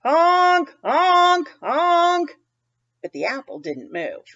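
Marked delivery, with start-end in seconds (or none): 0.0-2.4 loud, high honking; storyteller honking for Goose; goose honks. Acted. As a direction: honk like a goose trying to shake the apple down. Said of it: "He opened his beak wide".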